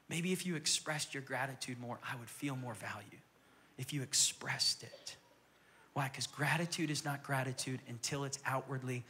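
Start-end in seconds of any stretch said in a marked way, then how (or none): 0.1-5.2 soft wife. Acted. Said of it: "the wife looks at the husband, she goes".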